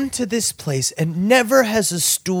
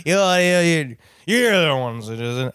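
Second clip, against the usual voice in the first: mumbled, slurred mock drunkenese; drunken woman; 3 semitones lower, slower than usual. Woman babbling at two men who can't follow, drunken slur. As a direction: garble it like an incoherent drunk. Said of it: "the only way to describe it is drunkenese".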